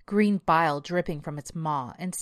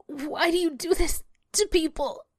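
put-on high-pitched strained girl; high, distressed questioning; desperate plea; 12 semitones higher than usual. A narrator's reading. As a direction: plead from under a crushing weight. Pinned girl pleads with beast.

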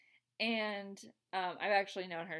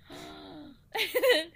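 mock gasp; 11 semitones higher, 5 dB louder than usual. Reacting to spilled drink.

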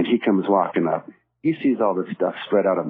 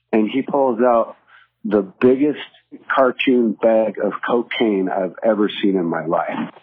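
ordinary talk

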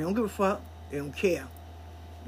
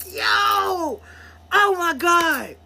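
retold shock; 8 semitones higher, 3 dB louder, much slower than usual. Own shocked reaction retold.

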